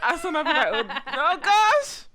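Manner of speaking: loud, high, laughing exclamation; laughing mock dismay. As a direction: crack up while playing at dismay. Joking about scrolling for birth year.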